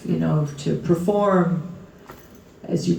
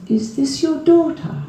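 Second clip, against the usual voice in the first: [name]; 9 semitones higher than usual. Retold or imitated. [name] voiced in anecdote.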